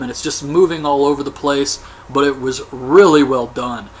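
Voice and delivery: normal talking voice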